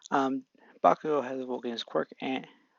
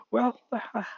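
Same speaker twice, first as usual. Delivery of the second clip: put-on higher, cocky teen drawl-free sneer; teenager Bakugo voice; 10 semitones higher than usual. Bakugo starts to answer Deku, higher teen voice. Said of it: "What am I doing with a teenager Bakugo voice".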